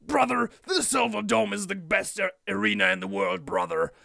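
loud Hogan promo voice, brother; hammed-up Hogan bluster; Hulk Hogan impression. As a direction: yell it like Hogan in the ring. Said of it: "he's like".

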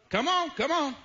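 high, eager call to the congregation; urging the crowd. Real, not acted. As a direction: coax the answer out of them.

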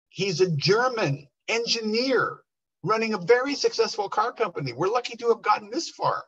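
colleague's high, emphatic objection; retold protest. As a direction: voice climbing in retold disbelief. Colleague objects, fears being fired.

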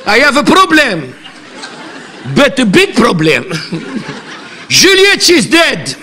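hammed-up Lebanese accent in English. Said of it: "il parle anglais avec cet accent".

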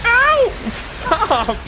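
high, sudden yelp of protest; pained yelp. Real, not acted. Player hit by electric enemies in a game, pained yelp. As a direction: cry out as if zapped.

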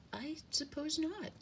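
high little bird voice; hesitant high-pitched sparrow; conceding sparrow. A narrator's reading. Sparrow reluctantly agrees with dove.

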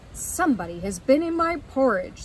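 lightly voiced Papa Bear, loud and firm; a loud storybook father bear. Papa Bear finds his porridge disturbed, father bear voice. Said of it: "said Papa Bear".

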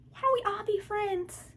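high, slow mock sweetness; mocking friend. Mocking friend who suggests they all be friends, high and slow. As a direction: sneer at the naive peacemaker. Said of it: "This motherfucker say".

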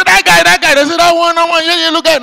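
excited pointing. Retold or imitated. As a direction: point him out to the police. Crowd points out the thief.

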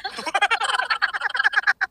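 loud high burst of laughter. Real, not acted. Laughs when the earphones hurt.

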